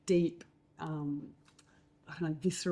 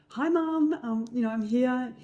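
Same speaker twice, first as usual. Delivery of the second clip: higher, louder greeting to mum; re-enacted phone call; 7 semitones higher, 5 dB louder than usual. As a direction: call out down the line to your mother. Acting out her phone call home.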